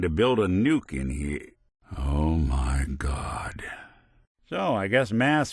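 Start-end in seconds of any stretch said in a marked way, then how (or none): 2.0-3.6 low, very slow stunned reaction; stunned awe. Acted. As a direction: draw it out in hushed disbelief. Stunned by what was built.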